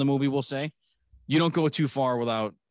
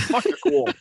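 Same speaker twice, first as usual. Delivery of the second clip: laughing mock rocker; 4 dB louder than usual. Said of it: "He goes".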